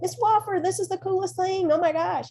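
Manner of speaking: high-pitched gushing colleagues; amazed teachers. Retold or imitated. Teachers walking by gush to Miss Wofford, high and amazed. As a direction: squeal it like a wowed coworker.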